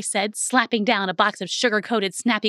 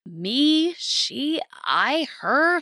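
each pronoun a higher, rising question; mixed-up; 5 semitones higher, much slower than usual. A narrator's reading. Girl in her mother's body unsure of pronouns, puzzled rising tries. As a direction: try out each word in puzzled confusion.

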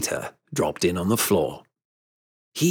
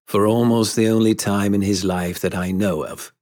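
ordinary delivery

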